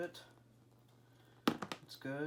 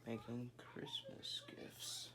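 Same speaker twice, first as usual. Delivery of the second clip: whispering; 7 dB quieter, slower than usual. Real, not acted. Quiet aside amid kids' noise.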